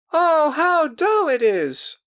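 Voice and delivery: very high, loud, slow drawn-out whine; bored girls crying. A narrator's reading. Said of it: "more voices cried".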